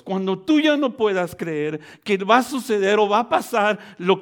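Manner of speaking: impassioned sermon, punching key words loudly; full-force preaching. Real, not acted. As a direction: drive each phrase home to the congregation.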